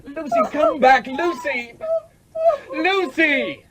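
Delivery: calling out. Acted. Miles calling after Lucy as she walks out, desperate. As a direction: shout after her as she leaves.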